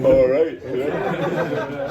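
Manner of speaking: loud, laughing reply; laughing all right. Retold or imitated. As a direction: laugh it out in disbelief. Retells reaction to secret bid.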